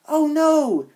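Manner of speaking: high, loud alarmed gasp. Acted. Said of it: "she gasped".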